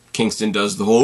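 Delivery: ordinary talk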